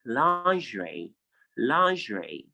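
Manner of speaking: lingerie slow and clear, /lɑnʒɹe/; model lingerie. Shown as a demonstration. Teacher leads repeat-after-me, slow lingerie. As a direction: say lingerie slowly for the class to repeat. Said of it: "say it after me".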